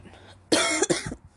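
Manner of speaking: harsh hacking cough; chesty cough. Real, not acted. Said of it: "sorry if I'm coughing so much".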